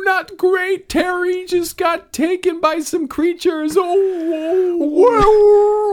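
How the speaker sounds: very high howled reply; howled yell. Acted. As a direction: howl back like a wolf. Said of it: "[name] yells back".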